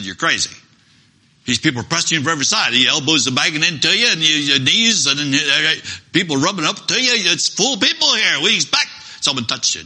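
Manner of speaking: fast, high, loud rush of disbelief; excited protest. Acted. Peter telling Jesus the crowd presses him, fast excited protest. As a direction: argue that the crowd is everywhere.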